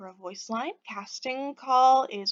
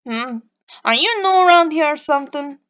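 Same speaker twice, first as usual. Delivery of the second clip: loud, raised Boston voice, nasal lilt; nasal Boston accent; 4 semitones higher, 7 dB louder than usual. Acted. Scowling stranger sizing up Pixie, nasal Boston accent. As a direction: probe suspiciously in a bad Boston accent. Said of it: "He has a nasal, lilting voice".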